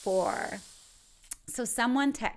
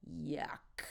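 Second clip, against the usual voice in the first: whispered, softer yuck of disgust; 5 semitones lower, 9 dB quieter than usual. Shown as a demonstration. After stepping in dog poo, a whispered yuck of disgust. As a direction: recoil and whisper it. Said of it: "yuck and ick express disgust".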